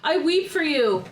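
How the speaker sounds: mock weeping walrus. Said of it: "Then he was like".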